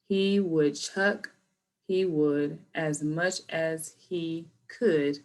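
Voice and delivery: slow, drawn-out words; slowed-down tongue twister. Shown as a demonstration. Teacher slowing the woodchuck twister for students, slow and deliberate. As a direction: go slow first, word by word. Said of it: "So I'm gonna slow down first".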